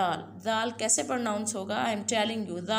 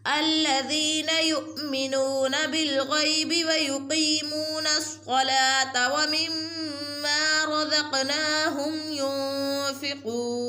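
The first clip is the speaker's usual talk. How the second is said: chanted tajweed recitation, raised and sung; Quran recitation; 5 semitones higher, 3 dB louder than usual. Shown as a demonstration. Teacher recites for viewers in sung tajweed.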